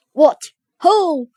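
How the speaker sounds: loud, gruff challenge; lightly gruff, loud demand; strange gruff voice. A narrator's reading. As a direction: call out in a put-on gruff voice. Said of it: "gruff voice".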